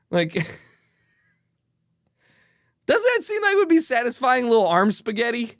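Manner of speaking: laughing. Real, not acted. Giggly intrusive thought.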